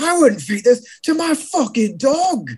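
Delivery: high, hammed-up angry chef; playful impression of a chef insulting the food; mock Gordon Ramsay rant. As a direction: bark it like a furious TV chef. Imitating Ramsay insulting burger.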